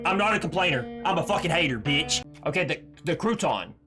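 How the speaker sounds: usual speaking voice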